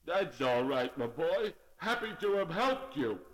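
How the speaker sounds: shivering horse voice; frozen voice. Acted. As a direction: talk through the cold, stiffly. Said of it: "answered in a very frozen voice".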